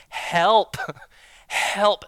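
high, loud, desperate plea; mock desperate shout; desperate cry for help. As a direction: call out for help, half laughing. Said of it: "wrote with all caps with exclamation point".

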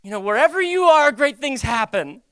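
high, loud plea to the Lord; trusting lament. Acted. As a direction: cry out in faith, drawing out the line ends. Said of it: "it's not a condescending way".

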